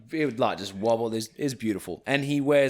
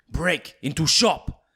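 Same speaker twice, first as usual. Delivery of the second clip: slow, louder, higher broken-English bouncer; 6 semitones higher, 5 dB louder, slower than usual. Retold or imitated. Bouncer telling friends about the shop, in slow, louder broken English. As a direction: clip the words like a foreign bouncer with little English. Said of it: "their English is, like, a bit broken".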